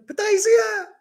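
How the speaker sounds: very high-pitched mock yell; high retold holler. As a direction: imitate an angry shout, pitch way up. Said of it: "he kept yelling".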